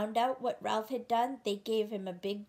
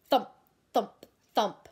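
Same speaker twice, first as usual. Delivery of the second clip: booming thump sound effect, louder; 6 dB louder than usual. Acted. Foxes banging at the door, loud thumps. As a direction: thump it out like heavy knocking.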